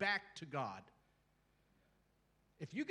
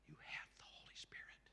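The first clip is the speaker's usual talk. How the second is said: a hushed, secret whisper; whispered reminder to the church; 12 dB quieter than usual. Real, not acted. Whispered emphasis to congregation.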